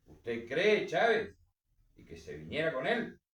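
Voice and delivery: loud, high challenging question; commanding challenge. A narrator's reading. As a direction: throw the question back, rising on the name. General challenges the man to fight. (desafío en voz alta, con autoridad)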